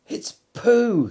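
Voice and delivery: high, loud eager cry; excited. A narrator's reading. Christopher Robin hearing Pooh sing, excited cry. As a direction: burst out with delight. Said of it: "said Christopher Robin excitedly".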